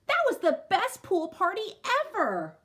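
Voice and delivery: thrilled schoolkid voice; excited kid. Acted. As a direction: rave about the party like a thrilled child. Excited kid at lunch table.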